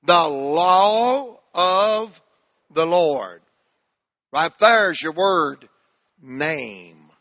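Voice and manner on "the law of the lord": "law" held long, phrase said very slowly. Shown as a demonstration. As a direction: stretch "The law of the Lord". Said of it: "Say that little phrase with me".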